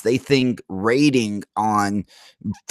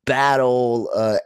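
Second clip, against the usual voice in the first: normal talking voice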